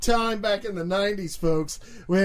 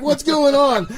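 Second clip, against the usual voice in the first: raised, higher, laughing exclamation; loud mock bafflement; 7 semitones higher, 8 dB louder than usual. Friend voices listeners confused by the prank, loud mock bafflement. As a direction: call it out as the baffled audience.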